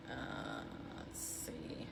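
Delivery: barely audible mumble to yourself, near-whisper; very low, hushed self-talk; under her breath. Real, not acted. Host checking the live stream to herself, under her breath.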